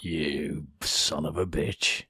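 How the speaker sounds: low, soft, slow growl of a curse; mock curse. Jokingly voicing the guard's comeback, slow and low. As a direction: drop deep and drag it out under your breath.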